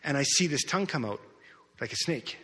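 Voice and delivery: ordinary delivery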